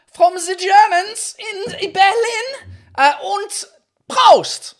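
put-on loud, high, slow German accent; hammed-up German toast, building to a shout; mock German accent.

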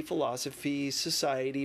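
ordinary delivery

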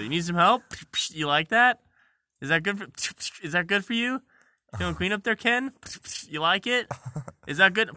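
high, loud, leering bidet; pushy, loud and high-pitched helper. Pretend. Bidet cleaning the user, high, loud and leering.

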